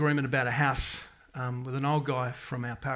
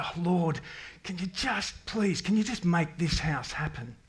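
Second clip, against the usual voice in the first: higher, slow, begging prayer; pleading out loud; 5 semitones higher, slower than usual. Retold or imitated. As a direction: plead with God, drawing each word out. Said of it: "We did say it out loud".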